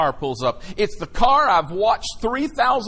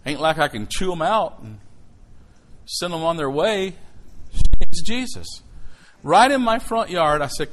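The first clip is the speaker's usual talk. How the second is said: ordinary talk, nothing marked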